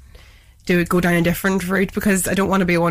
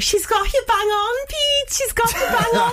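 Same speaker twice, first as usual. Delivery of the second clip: high, slow put-on voice of Abby, with a laugh; imitating Abby; slower than usual. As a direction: mimic a friend's teasing praise, pitched up and drawn out. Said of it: "Abby's like".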